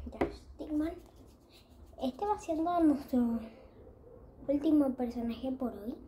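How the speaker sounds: usual speaking voice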